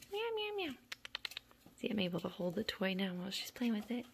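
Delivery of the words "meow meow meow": very high little mewing; kitten meows. Real, not acted. Coaxing kittens to meow, high mewing. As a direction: mimic a kitten, high and squeaky.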